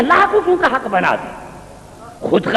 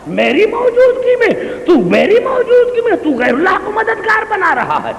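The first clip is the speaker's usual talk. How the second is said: divine rebuke; 10 semitones higher than usual. Acted. God's rebuke, retold with force.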